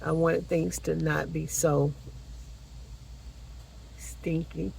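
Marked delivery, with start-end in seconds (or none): 4.0-4.7 soft breathy whisper on the word. Real, not acted. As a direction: drop to a playful whisper. Whispered punchline about a smelly dog.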